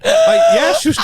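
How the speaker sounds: high squealing laugh breaking over the words; real helpless laughter; laughing shout. Real, not acted. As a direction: burst out laughing, loud and high. Laughing at own joke.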